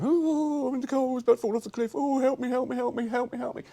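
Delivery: high, loud, fast mock pleading; hammed-up panic. Villain on the cliff edge, hammed-up loud high pleading. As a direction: beg for help like a cartoon villain. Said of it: "Locke ... was going".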